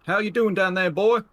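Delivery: high, loud call over the shoulder; older robber hollering down the carriage. Acted. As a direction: check on the boy from afar. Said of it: "sort of calling over his shoulder".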